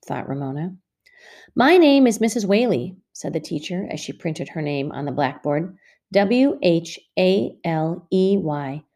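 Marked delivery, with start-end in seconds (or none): 1.5-3.0 a touch higher and louder, teacher voice; new teacher greets the second graders; teacher introducing herself. Acted. 6.1-8.8 slow, letter by letter; teacher spelling her name for the class. Acted.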